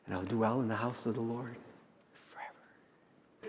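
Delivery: fading from soft down to a whisper. Acted. Pastor closing the psalm to his congregation, fading to a whisper. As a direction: let the last word vanish in a whisper.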